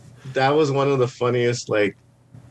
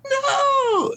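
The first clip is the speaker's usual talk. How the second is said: high crying no, far higher; scared cry. Retold or imitated. Frightened girl refusing the trash can, crying no. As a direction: whimper a refusal. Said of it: "getting all scared".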